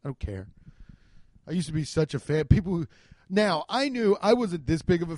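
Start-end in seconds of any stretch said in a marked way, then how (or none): 0.0-0.5 low, soft couldn't-care-less; careless. Retold or imitated. Speaker telling the salesman he doesn't care, low and offhand. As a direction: shrug it off, just hand it over. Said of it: "And I was like".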